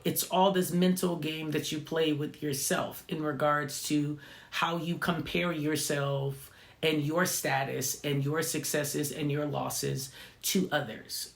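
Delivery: normal talking voice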